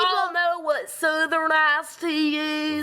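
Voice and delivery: put-on slow, drawn-out southern US accent; exaggerated southern accent. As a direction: ham up a slow southern twang. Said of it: "Bro is trying to say".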